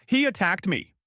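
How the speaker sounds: loud high accusing shout. A narrator's reading. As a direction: shout it out, pointing the finger. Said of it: "Foam Cop shouts".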